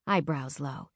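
ordinary delivery

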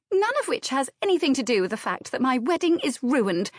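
fast, high, exasperated retort; snapped. A narrator's reading. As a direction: snap it out quickly in exasperation. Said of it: "snapped Anne".

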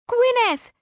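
very high, loud starstruck shriek; giddy high squeal; excited shriek. Acted. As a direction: shriek the name like a fan. Friend shrieks celebrity name.

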